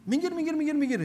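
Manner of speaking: high, loud thug ordering a driver aside; imitated urgent order. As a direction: bark it repeatedly, urgent and high. Gang orders him to pull over. (meniru preman menyuruh minggir dengan lantang)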